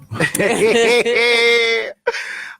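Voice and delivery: high burst of real laughter. Real, not acted. Host laughing at the guest's singing boast, hearty laugh. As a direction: laughs out loud at the guest's story.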